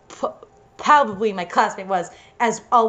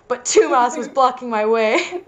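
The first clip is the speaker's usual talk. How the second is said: ordinary delivery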